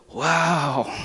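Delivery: put-on long, drawn-out wow from a child; awed wow. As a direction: mimic a kid's amazed wow. Voices awed kids' reply in story.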